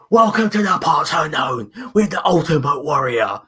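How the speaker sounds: imitated wrestler promo, pitched well up; Ultimate Warrior promo. Podcast host mimics a wrestler's incoherent promos for co-hosts, high promo voice. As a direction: announce it like a hyped-up wrestling promo. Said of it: "his incoherent fucking promos".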